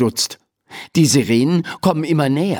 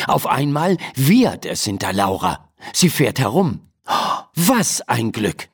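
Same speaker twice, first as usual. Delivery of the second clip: plain reading voice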